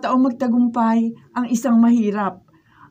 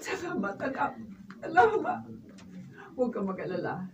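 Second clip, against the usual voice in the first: much softer, gentle reassurance; tender; 4 semitones lower, 12 dB quieter than usual. Acted. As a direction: comfort her softly. Mother tenderly comforts her daughter. (malambing at mahinang pag-alo)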